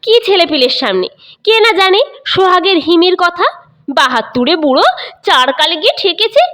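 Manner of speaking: quick, sharp comeback; angry retort. Acted. Mother snapping back at her husband, quick and sharp. Mother keeps scolding angrily.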